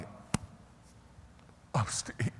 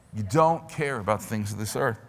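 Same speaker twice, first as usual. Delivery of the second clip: ordinary delivery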